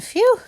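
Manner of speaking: high loud sigh of relief; relieved phew. A narrator's reading. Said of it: "said the dog".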